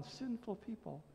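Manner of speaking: usual speaking voice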